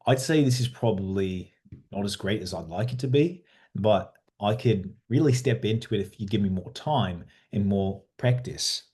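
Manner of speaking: steady put-on Australian accent. Shown as a demonstration. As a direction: talk like an Australian, modestly demonstrating. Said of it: "doing an Australian accent".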